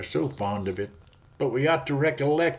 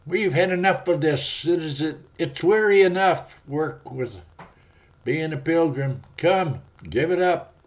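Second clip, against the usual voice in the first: higher, louder complaining voice; the flesh crying out; 6 semitones higher, 5 dB louder than usual. Acted. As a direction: whine to quit the journey. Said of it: "cries the flesh".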